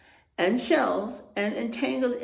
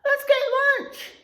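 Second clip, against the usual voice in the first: pitched up high and louder; bursting to go eat; eager Harry; 6 dB louder than usual. A narrator's reading. Teacher reading Harry, high and eager for lunch. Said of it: "he told Gary".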